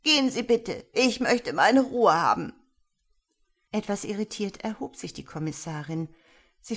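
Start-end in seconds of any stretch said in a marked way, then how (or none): 0.0-2.5 put-on loud, agitated old woman; early hysteria. A narrator's reading. Confused old woman sending the commissioner away, agitated and near hysterical. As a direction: plead loudly, pitch lurching. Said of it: "lag erste Hysterie in ihrer Stimme".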